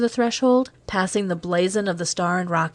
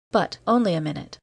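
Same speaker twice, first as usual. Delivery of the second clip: plain reading voice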